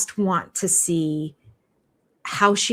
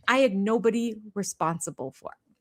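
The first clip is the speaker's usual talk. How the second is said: ordinary talk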